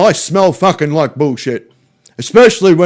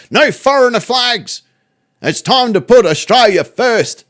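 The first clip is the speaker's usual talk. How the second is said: raised-pitch mock rallying cry; mocking sloganeer; 5 semitones higher than usual. Streamer mocking the march's slogans, in a raised mock-rallying voice. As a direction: proclaim it like a protest slogan.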